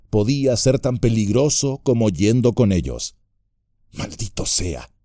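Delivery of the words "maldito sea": softer muttered curse. A narrator's reading. Sam cursing Gollum to himself, muttered curse. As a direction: grumble it under the breath. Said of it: "masculló".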